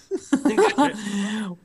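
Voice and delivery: helpless muffled laughing fit; can't stop laughing; hysterical laugh. Real, not acted. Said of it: "muted laughing fit".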